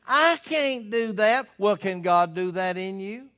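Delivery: preaching at full force. Acted. Preacher proclaiming to his congregation that God is able, loud. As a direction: thunder out God's might to the congregation.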